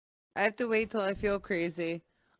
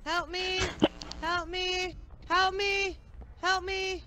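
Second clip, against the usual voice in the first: panicked calling; 9 semitones higher, 4 dB quieter, slower than usual. Real, not acted. Chased by killer, calling for help.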